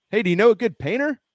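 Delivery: high, quick put-on asking voice; acted question. Host playing someone asking listeners for a painter, high quick acted question. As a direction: pitch it up as a chirped mock question. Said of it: "we always ask".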